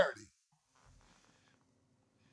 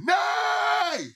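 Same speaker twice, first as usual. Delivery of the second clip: crowd shout. Retold or imitated. The convention crowd rejecting God, shouted high. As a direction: yell it like the whole convention floor. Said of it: "Everybody said".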